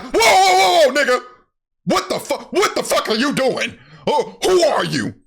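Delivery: very high mock-panicked outburst; freaking out. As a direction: yell it like a hammed-up freak-out, loud at first. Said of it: "She starts freaking out".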